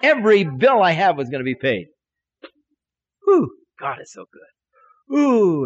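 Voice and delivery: loud, high, whooping relief; excited and high. Retold or imitated. Preacher reliving bills being paid, excited. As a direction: burst out with giddy joy. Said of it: "excited and so high".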